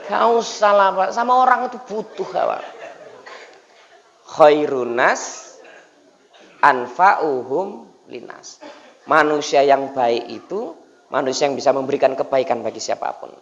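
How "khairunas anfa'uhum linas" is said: slow, measured Arabic recital; solemn recitation. Acted. Preacher recites the hadith to the congregation, slow and solemn. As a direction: intone it like a sacred text.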